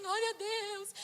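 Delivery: high, drawn-out mock crying; imitated weepy high voice; tearful praise. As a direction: wail thanks to God in mock sobs. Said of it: "Comecei a chorar".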